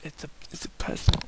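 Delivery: breathy hush into his ear; whisper. Acted. Pinkie whispering in his ear, sad hush. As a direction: whisper it close, near tears. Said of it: "she whispers in my ears".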